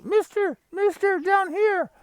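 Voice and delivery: high, soft little voice calling up from the ground; tiny frog voice. Acted. Frog calls to the passing old man in a tiny high voice. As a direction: squeak it up small, like a hidden frog.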